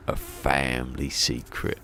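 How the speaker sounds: slow, low mocking echo of the phrase; mock incredulous repeat. Greg Davies slowly echoing the driver in mock disbelief.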